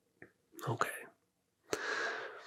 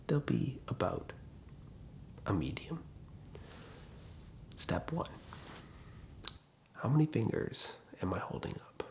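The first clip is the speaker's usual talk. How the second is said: soft guided voice; 4 semitones lower, 4 dB quieter than usual. Acted. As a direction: coax the listener toward sleep. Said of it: "not your standard A S M R whisper voice".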